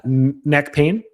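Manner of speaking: Southern accent. Real, not acted. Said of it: "I don't know why I did that accent".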